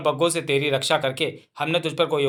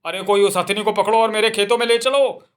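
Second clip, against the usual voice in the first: higher, louder call to catch her; eager shout; 6 semitones higher, 4 dB louder than usual. Acted. Farmer shouting for the elephant to be caught and brought back. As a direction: shout to the villagers.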